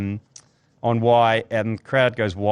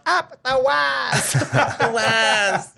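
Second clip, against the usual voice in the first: put-on excited little girl; 12 semitones higher, much slower than usual.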